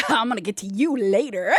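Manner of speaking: low, playful come-on; mock seductive. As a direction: drop low, half-joking promise. Imagines seductive line to chosen one.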